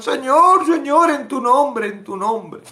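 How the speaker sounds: high, loud begging call; acted believers crying out their plea to the Lord; pleading believers. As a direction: plead desperately.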